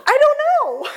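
high, laughing I don't know; half laugh the answer out, unsure; laughing at yourself while retelling the moment; nervous laughing reply. Retold or imitated. Nervous answer to Leanne's question.

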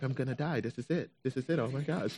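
softly muttered mock panic; retold panic. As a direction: fret under your breath, acting it out. Retold panic after phone call.

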